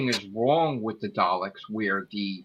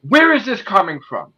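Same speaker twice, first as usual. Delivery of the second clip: a raised, mildly angry repeat; mad Davros; 6 semitones higher, 5 dB louder than usual. Retold or imitated. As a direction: demand it again, mock mad. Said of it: "but really mad".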